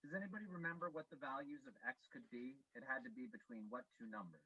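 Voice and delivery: muffled, distant audio; like through a wall; far away. Real, not acted. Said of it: "You sound so far away".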